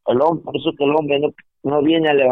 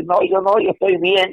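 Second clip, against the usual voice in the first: high, dismissive brush-off, imitating the scoffer; mock the proud man's high-pitched no, he's fine; preacher mimicking an unbeliever who shrugs off his need, in a high mocking voice; mock denial; 6 semitones higher than usual.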